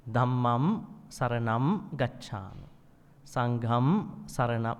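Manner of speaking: slow "Buddham saranam", closing on m; m endings. Shown as a demonstration. Teacher shows monks' ordination chanting with m. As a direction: shut the lips on "Buddham". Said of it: "It ends in M".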